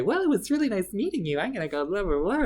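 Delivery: a hint of a cast member politely escaping; polite brush-off. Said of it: "she just ended up walking away".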